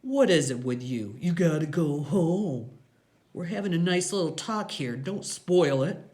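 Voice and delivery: sneering gang boss; Iggy, mocking menace. A narrator's reading. Voices Iggy in story.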